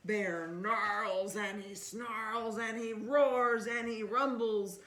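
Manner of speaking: loud, slow, rumbling read-aloud; dramatic storybook reading. Acted. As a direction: read the bear's rage big and slow. Reading picture book aloud.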